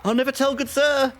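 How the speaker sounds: very high, loud goblin squeak; cheeky fleeing goblin. Acted. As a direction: taunt them as you run off. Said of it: "as it runs away".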